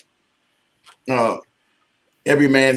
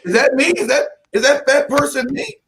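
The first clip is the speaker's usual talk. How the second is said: disbelief; 8 semitones higher than usual. Retold or imitated.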